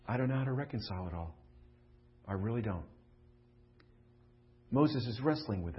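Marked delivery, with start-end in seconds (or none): 0.1-2.9 sincere confession. Real, not acted. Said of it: "honestly, as your earthly pastor".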